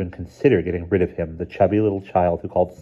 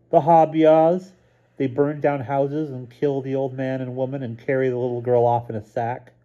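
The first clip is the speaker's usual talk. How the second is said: put-on a child relishing the scary story; little boy telling a spooky tale; 7 semitones higher than usual. A narrator's reading. Stevie repeats slower, spooky tale.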